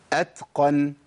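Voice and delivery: أتقن with an audible puff of breath after the ت; hams on ta. Shown as a demonstration. Teacher letting students hear the whisper of ت in أتقن, breathy release. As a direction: let breath flow through the ت in أتقن. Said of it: "اسمعوا الهمس".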